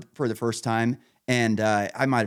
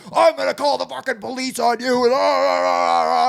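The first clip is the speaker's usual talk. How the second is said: high, loud mock yell of a raging neighbor; hammed-up screaming neighbor; imitating a furious neighbor; 12 semitones higher, 6 dB louder than usual. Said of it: "he flipped the fuck out".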